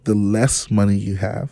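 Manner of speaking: measured, slow delivery; slow down so the point lands; slow repeat. Shown as a demonstration. Guest finishes the repeated money rule for the host, slowly. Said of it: "Say it again, but say it slow".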